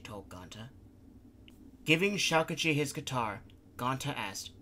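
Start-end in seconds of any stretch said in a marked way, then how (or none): none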